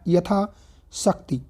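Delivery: यथाशक्ति stretched very slowly syllable by syllable; slow dictation. Shown as a demonstration. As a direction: spell out यथाशक्ति slowly for students. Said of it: "यथाशक्ति होता है".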